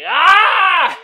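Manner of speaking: loud, high mock scream; kid's scream. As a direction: scream like the kid in the meme.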